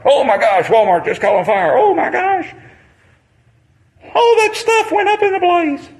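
high, breathless gasping reporter; hammed-up newscaster alarm; mock news reporter. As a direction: squeal it like a shocked TV anchor. Mocking news reporters' excited reaction.

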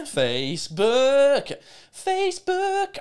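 sing-song call to the platform; mock calling out; drawn-out call. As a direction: stretch Facebook out, rising very high. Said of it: "calling out to Facebook".

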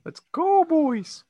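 squeaky high cheer; high-pitched cheer. Acted. Cheering the squad.

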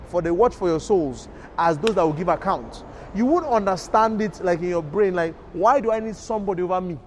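loud, high preaching voice; full-force preaching. Acted. Preacher to the congregation, loud and driving. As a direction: belt the scripture out to the congregation.

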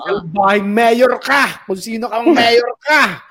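slow, drawn-out mock scolding of the mayor. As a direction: scold him slowly in a put-on voice, half joking. Host scolds the rude mayor. (mabagal at pabirong pagsermon sa mayor)

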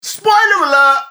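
high, loud call of "Spoiler alert"; loud spoiler warning. Real, not acted. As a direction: shout it out to warn everyone listening. Warning listeners about spoilers.

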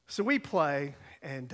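ordinary talk

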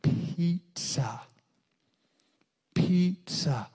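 put-on over-articulate it for a child to lip-read; father coaxing his daughter to say the word; modelling a word. Shown as a demonstration. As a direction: clear slow Pizza, shaped with the lips. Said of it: "Look at my lips".

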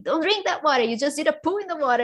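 high, loud mock warning; scolding partner. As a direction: imitate an alarmed partner telling someone off. Partner scolding her in labor, retold.

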